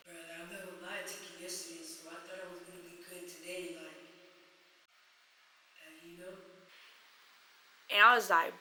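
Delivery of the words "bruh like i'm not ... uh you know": very soft, low imitated confession; hushed confession. Him admitting he took the car, hushed. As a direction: confess it barely above a murmur.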